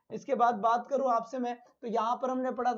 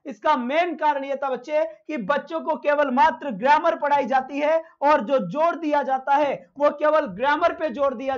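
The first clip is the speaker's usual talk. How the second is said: louder, projecting to the whole class; teacher calling out over a poor connection; teaching loudly; 3 semitones higher, 5 dB louder than usual. Real, not acted. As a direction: raise your voice so every student hears. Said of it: "voice में तेज चिल्लाऊंगा".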